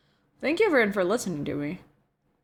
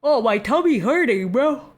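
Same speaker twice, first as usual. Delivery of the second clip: whiny mock complaint; 4 semitones higher, 5 dB louder than usual.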